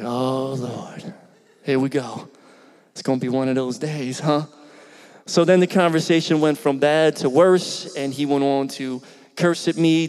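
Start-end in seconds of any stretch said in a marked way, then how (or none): 0.0-4.5 weary sigh. Retold or imitated. Pastor realizing the call will go badly, weary and resigned. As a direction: lower and softer, resigned exasperation.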